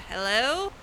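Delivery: normal talking voice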